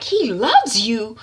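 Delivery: slow, drawn-out mocking question; scornful echo. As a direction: throw the words back in disbelief. Mother mocks daughter's words.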